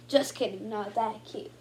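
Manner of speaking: usual speaking voice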